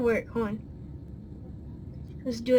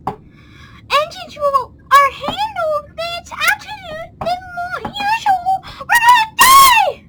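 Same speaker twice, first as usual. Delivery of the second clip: high, slow screaming pilot; panicked pilot voice; 5 dB louder than usual. Pretend. Mock pilot panic announcement.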